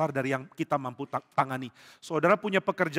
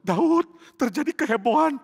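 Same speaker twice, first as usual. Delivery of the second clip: alarmed report; 11 semitones higher, 7 dB louder than usual. Acted. Soldiers rush report to David.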